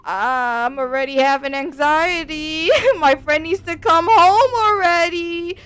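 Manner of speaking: high, loud, slow half-crying panic; panicked whimper. Retold or imitated. Alone in the house, panicking, high crying voice. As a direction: act out the retold panic, voice cracking. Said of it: "And I'm just like".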